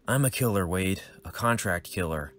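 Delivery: ordinary delivery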